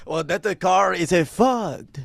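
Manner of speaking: slow, hammed-up French accent. Officer grumbles about the broken car in French accent. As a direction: complain slowly in a mock French accent. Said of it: "we do the French accent".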